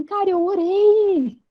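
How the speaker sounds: high, drawn-out exclamation to yourself; amused disbelief. Retold or imitated. Speaker recalling her first prayer aloud, amused high exclamation. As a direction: marvel at it, stretching the last word into a laugh. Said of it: "começo a rir e falo assim".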